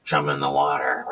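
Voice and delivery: same line dropped right down low; low mock voice. Joking about trolls as sharks, low mock voice.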